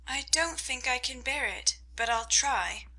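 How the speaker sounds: Amy thinks it quietly; inner thought. A narrator's reading. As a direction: say it to yourself, softly. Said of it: "thought Amy".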